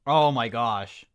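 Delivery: ordinary talk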